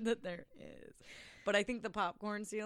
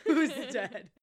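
laughing echo; 5 semitones higher, 4 dB louder than usual. Retold or imitated. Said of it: "all caps".

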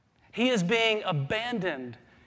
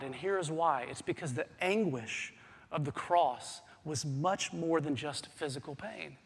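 ordinary delivery

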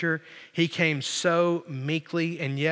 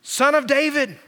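high, ringing call; crying out; 8 semitones higher, 3 dB louder than usual. Retold or imitated. Blind men hail Jesus, crying out. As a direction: call the title out high, falling at the end. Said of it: "began to cry out to Jesus".

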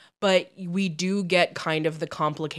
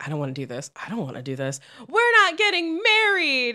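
building mock protest; 7 semitones higher than usual. Acts out couple's refusal, building.

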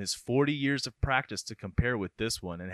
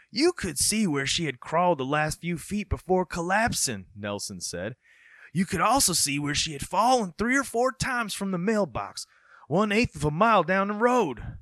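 put-on high, folksy rural accent; 6 semitones higher than usual. Folksy accent quoting the man who found her. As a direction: tell it like a country neighbor. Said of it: "I don't know if accents are like that in Minnesota".

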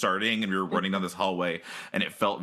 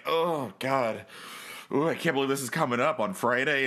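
dreading; 7 semitones higher than usual. Retold or imitated. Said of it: "I'm like".

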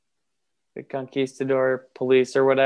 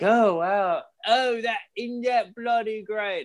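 higher, slow mock English accent; 7 semitones higher, slower than usual. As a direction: ham up a cheery English bloke at the pub. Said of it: "And I've got an English accent".

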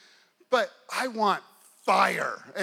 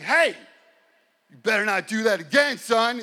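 stern warning; 2 semitones higher, 7 dB louder than usual. Retold or imitated. Acting out angry warning to player.

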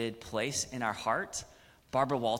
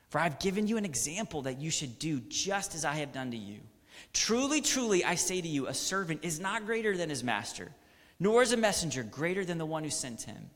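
raised, fast preaching of Jesus's words; scripture reading; 5 semitones higher, 4 dB louder than usual. Acted. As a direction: proclaim the verses with full conviction. Reads Jesus's words from John.

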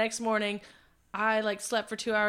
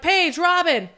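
loud, higher call to friends; 7 semitones higher, 8 dB louder, slower than usual. Retold or imitated. Storyteller summons the friends to the car, calling out. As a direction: call them over, voice lifted.

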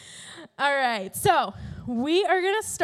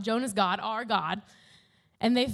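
ordinary talk, nothing marked

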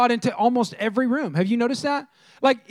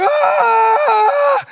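put-on high, loud scared kid scream; terrified scream; 7 dB louder than usual. A small boy jolted awake by his alien-voiced mom, screaming. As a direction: shriek like a four-year-old jolted awake. Terrified four-year-old wakes up.

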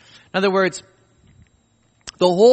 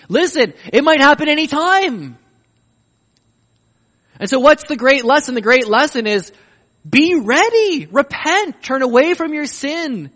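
louder, higher ringing exhortation; impassioned climax; 6 semitones higher, 5 dB louder than usual. Real, not acted. Preacher hitting the great lesson, impassioned. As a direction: preach it at full force.

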